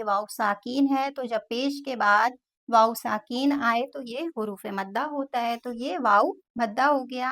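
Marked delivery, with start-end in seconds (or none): none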